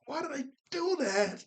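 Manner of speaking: high, mock self-reproach; Ragatha mad at herself. Recalling Ragatha's outburst at herself, high and self-blaming. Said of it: "She sounded so mad at herself".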